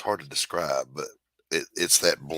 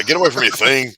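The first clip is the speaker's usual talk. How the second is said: drill-instructor shout; 7 semitones higher, 11 dB louder than usual. Retold or imitated.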